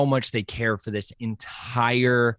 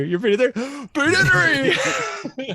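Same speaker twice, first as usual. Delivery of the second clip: high gasping Peter three, slow and amazed; breathless high-pitched awe; awed gasp; 13 semitones higher, slower than usual. Retold or imitated. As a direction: gasp it out in wide-eyed awe. Acting out the scene, gasping.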